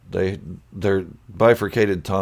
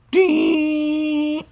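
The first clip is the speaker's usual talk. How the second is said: high ding. Retold or imitated. Reading a donation alert.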